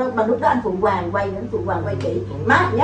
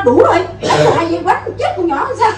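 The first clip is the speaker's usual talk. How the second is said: raised, laughing mock indignation; imitating mother's protest; 7 semitones higher, 5 dB louder than usual. Actress quoting mother refusing a retake of the beating, high mock protest. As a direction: object sharply, higher as it goes. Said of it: "xong rồi má nói".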